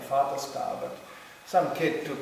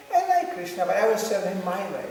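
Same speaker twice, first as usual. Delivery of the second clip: self-willed gopi; 5 semitones higher, 5 dB louder than usual. Pretend. Selfish gopi voiced.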